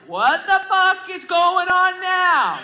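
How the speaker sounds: drawn-out, high-pitched exasperation; exasperated outburst. Retold or imitated. Comic reacts to hearing the family arguing, exasperated.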